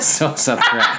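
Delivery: a hint of a Valley Girl, laughing. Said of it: "You sounded like a Valley Girl".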